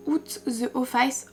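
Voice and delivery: English words in a French accent, slow and soft; accent français en anglais. Real, not acted. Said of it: "désolée pour mon accent anglais". (accent français, lentement et doucement)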